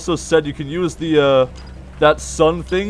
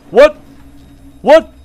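startled what; 6 semitones higher, 7 dB louder than usual. Real, not acted. Sudden jump scare.

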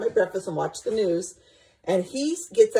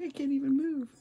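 cat voice; 8 semitones higher, 6 dB quieter than usual. Retold or imitated. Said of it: "She's like".

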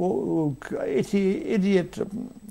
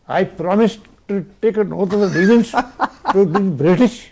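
mock solemn oath; 4 semitones higher, 6 dB louder than usual. Mock oath among foreigners.

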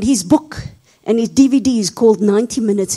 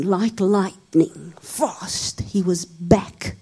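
hushed drama; 4 semitones lower, 7 dB quieter, much slower than usual. A narrator's reading.

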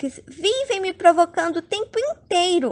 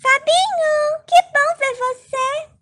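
flirty fan voice; 9 semitones higher than usual. Acted. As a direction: coo his name like a smitten fan.

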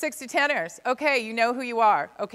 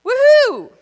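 very high, loud whoop; whooping cheer; 12 semitones higher, 13 dB louder than usual. Real, not acted. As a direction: let out a big celebratory whoop. Cheering after audience says yes.

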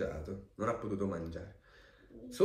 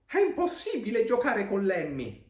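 put-on high, loud childlike protest; complaining schoolmates; 11 semitones higher, 5 dB louder than usual. A narrator's reading. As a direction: protest like exasperated kids in a storybook. Compagni che si lamentano di Lemmy. (compagni che protestano a voce alta)

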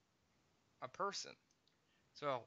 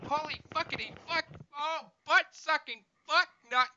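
high excited cursing; 12 semitones higher, 9 dB louder than usual. Acted. Playful cursing spree.

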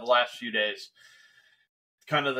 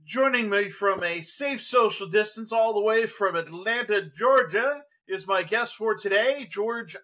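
raised-pitch, grand show-host introduction; showman announcer; 5 semitones higher than usual. Acted. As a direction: announce yourself and your guest with a flourish. Host announces himself and guest.

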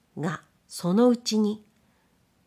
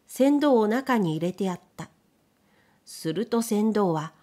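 plain reading voice

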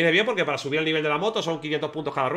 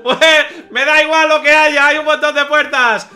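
high, loud, drawn-out whoops of delight; euforia real; 7 semitones higher, 9 dB louder, much slower than usual. Real, not acted. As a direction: shout it out stretched, crowing with glee. Celebra el hallazgo de puertas. (grito eufórico alargado)

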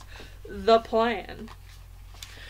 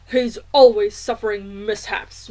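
lightly voiced grumpy father, louder; grumbling father; 8 dB louder than usual. A narrator's reading. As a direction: complain as a fed-up dad.

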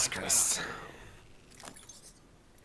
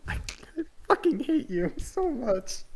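high exasperated whine. Real, not acted. Mock fury at a teammate.

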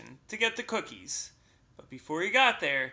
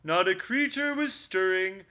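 louder, higher recital, slowing at the end; poem recital; 5 semitones higher, 6 dB louder than usual. Acted. Bear reciting the poem at Mouse, pointed. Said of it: "Bear pronounced".

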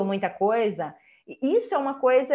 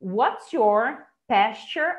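slow WHAT'S YOUR PASTURE, stress on PAS; measured teacher's model of "pasture"; stressed syllables marked; much slower than usual. Shown as a demonstration. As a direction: punch the first syllable of pasture, rising. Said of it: "as estressadinhas tá sublinhado".

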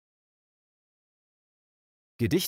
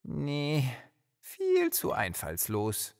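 dissatisfied; 4 semitones higher, 4 dB quieter, much slower than usual. Acted. Character rejects his own poem.

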